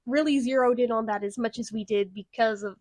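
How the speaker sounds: ordinary talk, nothing marked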